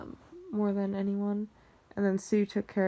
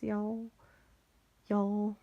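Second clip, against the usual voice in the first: lightly imitated country twang; a touch of small-town Southern accent. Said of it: "country accents".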